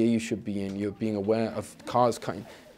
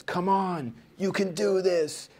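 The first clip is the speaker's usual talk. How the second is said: high, slow American coach pep talk; American sports pep talk; 9 semitones higher, slower than usual. Retold or imitated. Athlete alone in the changing room, American pep talk. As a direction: psych yourself up like an American athlete. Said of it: "I had to do it in a, in an American accent".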